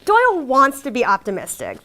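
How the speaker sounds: ordinary talk, nothing marked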